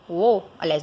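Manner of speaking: normal talking voice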